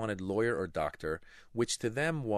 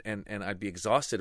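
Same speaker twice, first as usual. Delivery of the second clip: ordinary talk, nothing marked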